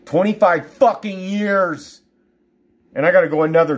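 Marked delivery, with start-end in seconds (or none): 0.1-2.0 high, slow, drawn-out anger; real exasperated outburst; angry outburst. Real, not acted. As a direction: stretch each word out, pitched up in anger. Repeats the years, escalating in anger.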